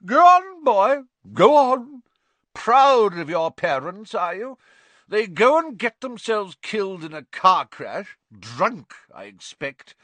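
put-on very high, loud drunken jeer; loud, drunk, mocking aunt; taunting Aunt Marge. A narrator's reading. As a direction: goad the boy into a fight.

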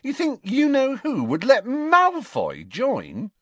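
high, loud, incredulous laughing speech; erupting in laughter. A narrator's reading. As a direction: laugh through it in disbelief, stretching the words. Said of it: "Ron erupted in laughter".

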